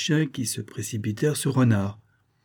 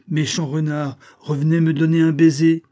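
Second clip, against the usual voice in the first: high, loud taunting call; mock scolding, raised and carrying; 7 semitones higher, 7 dB louder than usual. A narrator's reading. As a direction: call the rascal back. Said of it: "cria la mésange".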